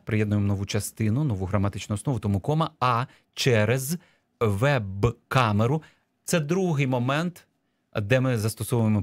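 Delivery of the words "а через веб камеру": drawn-out words, set apart; slow dictation. Shown as a demonstration. Teacher reading the dictation text back, slow and segmented. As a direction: dictate it piece by piece. Dictation phrase read out slowly.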